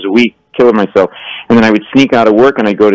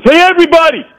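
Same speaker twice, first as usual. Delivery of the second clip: high-pitched hollering to a crowd; mock screaming. Slashed man to the whole bar, imitated screaming. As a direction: yell across the room as a drunk. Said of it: "Screaming, yeah".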